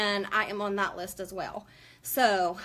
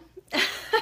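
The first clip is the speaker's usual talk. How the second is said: teary laugh; 9 semitones higher, 3 dB louder than usual. Real, not acted. Emotional laugh fighting tears.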